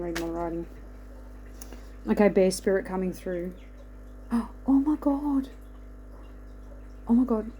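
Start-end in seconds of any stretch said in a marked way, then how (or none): 4.3-7.5 gasp of surprise. Real, not acted. Sees a bird appear.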